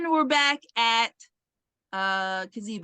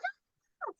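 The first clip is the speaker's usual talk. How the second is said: short high yelp, much softer, breathy and far away; mock scream; 11 dB quieter than usual. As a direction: imitate her tiny high-pitched shriek. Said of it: "and she's like".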